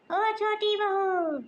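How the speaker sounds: long drawn-out call. Acted. Mother-in-law hollering slowly for daughter-in-law. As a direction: drag out बहू as a long high call to the next room. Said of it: "क्यों चिल्ला रही हो?".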